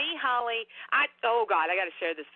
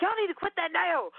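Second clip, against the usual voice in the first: high, raised call up the stairs; retold yell at the noisy kids, pitched high; yelling upstairs; 10 semitones higher than usual. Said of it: "I yelled up there".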